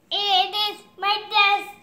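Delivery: sung robot chant; singsong robot. Pretend. Child playing robot.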